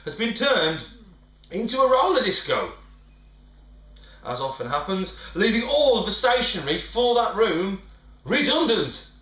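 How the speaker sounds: ordinary delivery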